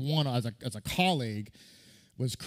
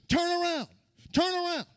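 high, barking drill sergeant yell at recruits; screaming drill sergeant; drill instructor yelling. Retold or imitated. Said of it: "he told the platoon".

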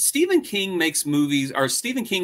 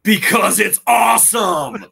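excited shout; 5 semitones higher, 9 dB louder than usual. Real, not acted. Co-host cheering the movie, excited shout. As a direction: cheer it out loud and slow.

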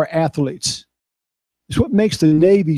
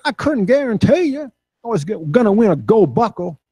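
higher imitation of a Texas cowboy; higher bull rider voice; Ty Murray's voice; 6 semitones higher than usual. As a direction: imitate Ty's earnest cowboy talk. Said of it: "this is how he talks".

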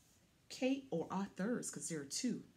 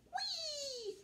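high, gleeful whee down the slope; light, high-pitched joyful whee; playful whee; 6 dB quieter than usual. Pretend. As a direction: squeal it like a ride. Truck rolls down the slope.

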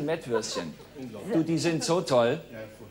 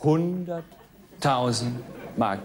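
slow dramatic; 4 semitones lower, much slower than usual. Shown as a demonstration. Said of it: "slow dramatic sum".

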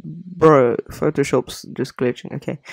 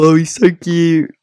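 cooing; 3 semitones higher, 7 dB louder than usual. Real, not acted. Cooing over Kirby.

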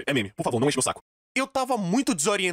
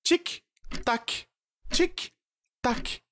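higher, spaced-out tick tock; mock clock ticking; 7 semitones higher, much slower than usual. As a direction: tick like a clock, each word alone.

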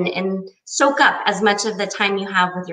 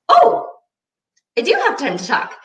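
leaps much higher on oh, thrilled; thrilled eagerness; 10 semitones higher than usual. Retold or imitated. Answering the Rachael Ray producer on the phone, thrilled. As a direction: gush that you do have time.

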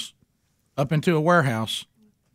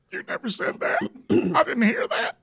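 put-on squeaky kid protest; 10 semitones higher than usual. Younger brother protests, voiced.

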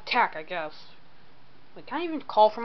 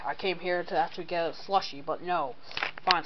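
normal talking voice

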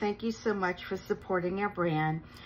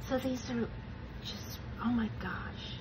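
soft breathy oh my gosh; hushed awe; 3 semitones higher, 7 dB quieter than usual. Real, not acted. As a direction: whisper it in wonder. Seeing the flower transfers.

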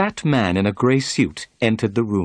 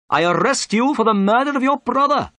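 high-pitched, emphatic inspector; pompous policeman making an arrest; stern inspector; 14 semitones higher, 4 dB louder than usual. A narrator's reading. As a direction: pronounce it officially in a high character voice.

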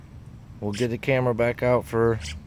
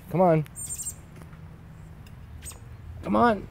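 very high sing-song call; coaxing a wild bird; 10 semitones higher, 4 dB quieter than usual. Real, not acted. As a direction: coax the bird with a lilting high voice. Calling a bird to follow.

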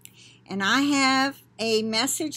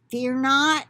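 louder, ringing proclamation of the words; prophetic declamation; 3 semitones higher, 5 dB louder than usual. Acted. Reading the prophecy aloud to viewers, declaiming. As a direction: announce it like scripture from the pulpit.

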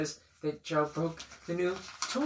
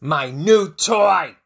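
loud, drawn-out wail of outrage; acted storybook shout; mad outburst; 11 dB louder, slower than usual. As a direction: shout it slowly as the wronged friend. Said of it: "Piggy just says right out loud".

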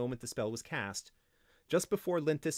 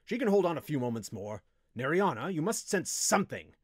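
insistent; 3 semitones higher, 4 dB louder than usual. A narrator's reading. Wizard overrules windling, presses the slave.

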